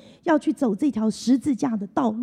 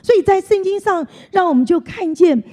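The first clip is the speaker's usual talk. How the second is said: preaching; 3 semitones higher, 5 dB louder than usual. Acted. Sermon climax on Jerusalem.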